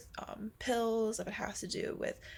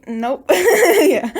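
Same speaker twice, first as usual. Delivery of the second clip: laughing; 8 semitones higher, 21 dB louder than usual. Real, not acted. Laughing at the doctor's prompt.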